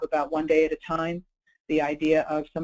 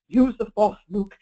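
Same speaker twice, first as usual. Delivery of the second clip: lightly imitated British Obi-Wan Kenobi; British accent; 2 semitones higher, 4 dB louder, slower than usual. Lecturer quoting Obi-Wan's voice to Luke, in a British accent. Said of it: "saying in that British accent".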